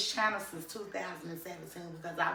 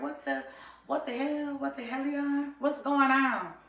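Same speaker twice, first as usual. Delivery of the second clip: shocked retold reaction; 8 semitones higher than usual. Retold shock at phone news.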